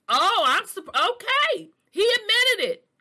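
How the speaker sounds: high, loud stunned disbelief; shocked. Real, not acted. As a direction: gasp it out, floored. Shocked by what she read.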